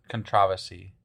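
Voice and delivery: "controversy" as con-TROV-ersy; British pronunciation. Shown as a demonstration. Co-host trying the form she likes, "controversy" as con-TROV-ersy. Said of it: "I kinda like con".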